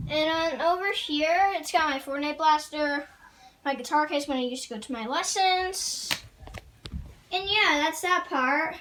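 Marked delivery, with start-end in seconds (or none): none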